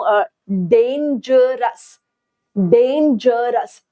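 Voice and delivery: डेंजरस said slowly, syllable by syllable: डें-ज-रस; split into syllables. Shown as a demonstration. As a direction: break डेंजरस into its three parts, slowly. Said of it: "तीन टुकड़े बन रहे हैं इस word के".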